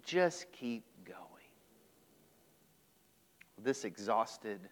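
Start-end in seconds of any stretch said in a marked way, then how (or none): 0.5-1.2 breathy whispered question; whispered tired question. Real, not acted. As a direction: drop to a whisper on the last words. Asking listeners about exhaustion.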